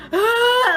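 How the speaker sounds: high, loud gasped "ah"; hammed-up freak-out gasp; imitated panicked gasp. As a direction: suck in air like mock panic. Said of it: "I'm freaking out".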